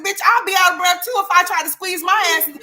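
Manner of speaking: high, loud mocking retort. Real, not acted. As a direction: bark the comeback loudly.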